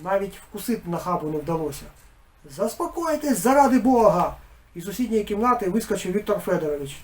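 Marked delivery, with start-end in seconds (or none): 2.6-4.4 high, louder plea to calm down; pleading shout. A narrator's reading. Village head begging everyone to calm, high pleading shout. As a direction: beg them for God's sake. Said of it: "голос тремтить".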